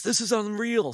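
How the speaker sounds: high, loud imitated amazement; amazed. Director trying the backstage headsets, high mock amazement.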